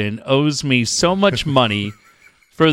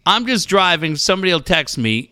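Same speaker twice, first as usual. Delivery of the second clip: ordinary talk, nothing marked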